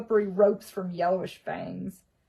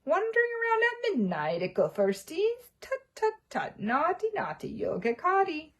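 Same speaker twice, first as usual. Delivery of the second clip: high, mocking poltergeist voice; much higher teasing sing-song; cackling poltergeist; 11 semitones higher than usual. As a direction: taunt them like a gleeful poltergeist. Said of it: "Peeves cackled".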